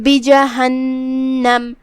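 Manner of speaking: بجہنم recited slowly with a nasal ghunna on the م; iqlab demo. Shown as a demonstration. Teacher models iqlab on بجہنم, slow and nasal. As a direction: chant بجہنم slowly, humming the م. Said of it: "تنوین کو میم میں پلٹ کے غنہ کے ساتھ".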